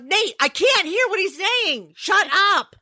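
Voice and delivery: high-pitched retold scolding; exasperated mom.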